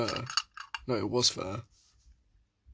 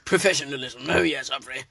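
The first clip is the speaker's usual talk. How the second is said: put-on posh accent; 3 dB louder than usual. Echoing posh voice bit.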